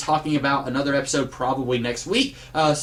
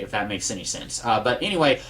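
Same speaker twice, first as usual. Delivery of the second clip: ordinary talk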